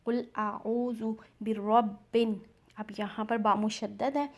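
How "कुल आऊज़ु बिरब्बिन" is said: slow, drawn-out recitation; Quran recitation. Shown as a demonstration. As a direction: recite with stretched vowels. Recites the verse.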